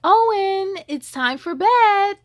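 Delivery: loud and high, calling down the hallway; mom yelling. A narrator's reading. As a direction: call him to bed from the hall. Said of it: "Mom yelled from the hallway".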